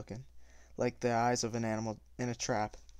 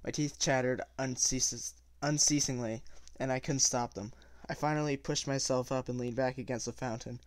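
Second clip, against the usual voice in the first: ordinary delivery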